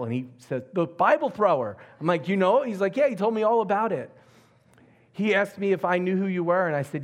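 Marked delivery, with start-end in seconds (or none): 0.7-1.8 loud, high exclamation; excited recognition. Retold or imitated. Excited retold phone exchange. 2.3-4.1 high, loud retold surprise; excited exchange. Surprised exchange about Bible thrower.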